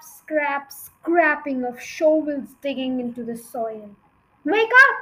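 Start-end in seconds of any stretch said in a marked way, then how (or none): 4.4-5.0 high, loud wake-up call; urgent shout. A narrator's reading. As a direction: shout to rouse a sleeper in alarm. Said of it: "he shouted".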